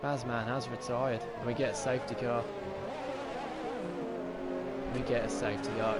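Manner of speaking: live race play-by-play; fast excited racing commentary; motorsport commentator. Real, not acted.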